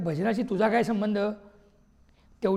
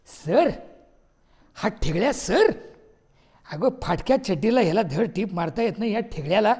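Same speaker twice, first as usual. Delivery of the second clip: scornful disbelief; 4 dB louder than usual. Acted. Husband scoffs at wife calling Thigle sir. Husband mocks the visiting teacher.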